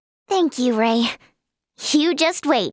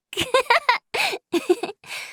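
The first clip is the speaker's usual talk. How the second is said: high, light giggle; 7 semitones higher than usual. Acted. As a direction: giggle freely for a moment. Laughs during friendly chat with Ray.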